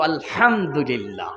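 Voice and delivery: low, slow, drawn-out praise; contented sigh. Acted. Daughter answering her mother, slow and content.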